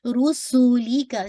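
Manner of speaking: रुसूली का slowly, each letter given one count; slow recitation demo. Shown as a demonstration. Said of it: "सीन के अंदर व्हिstling sound".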